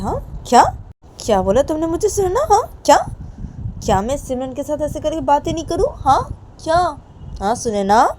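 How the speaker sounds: high, loud indignant questioning; outraged mother. Acted. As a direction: fire back the questions in disbelief. Mother angrily challenges daughter.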